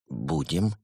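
cowed, soft squeezed-out reply. A narrator's reading. Cornered penguin gives in quietly. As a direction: force the word out, shrinking. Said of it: "выдавил из себя съёжившийся Евгений".

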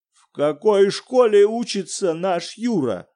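a lightly voiced panicked father; ужасным голосом; in a terrible voice. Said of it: "ужасным голосом".

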